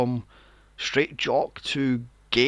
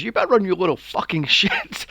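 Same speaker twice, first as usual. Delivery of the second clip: villain taunt; 6 semitones higher, 6 dB louder than usual. Retold or imitated. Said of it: "He goes".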